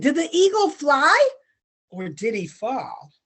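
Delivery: usual speaking voice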